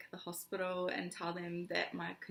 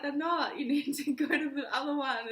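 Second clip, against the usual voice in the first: urgent insistence; 7 semitones higher, 6 dB louder than usual. Retold or imitated. Arguing with husband in car.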